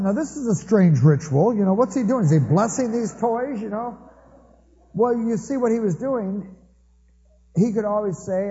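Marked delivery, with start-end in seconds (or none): 0.0-4.0 higher, louder puzzled musing; puzzled wonder. Retold or imitated. Uncle baffled watching nephew touch every toy, puzzled and raised. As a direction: wonder aloud at a strange ritual.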